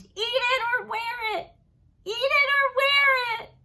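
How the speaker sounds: very high, loud screaming chant, slowing on the repeat; toddler screaming. Acted. Fudge screaming at his father over the omelet, tantrum shout. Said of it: "Fudge screamed".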